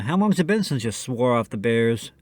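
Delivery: ordinary talk, nothing marked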